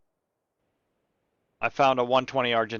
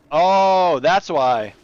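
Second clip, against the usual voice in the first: sudden realization; 4 semitones higher, 9 dB louder, much slower than usual. Real, not acted. Realizes why the fight is hard.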